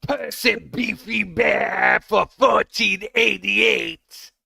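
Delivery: slow shouted demon voice; Exorcist demon voice. Acted. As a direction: drag it out, shouting. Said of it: "in the Ashton voice".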